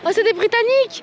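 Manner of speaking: light up at the news; surprised. Real, not acted. Vlogger realising the passers-by were British, surprised. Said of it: "realises they are British".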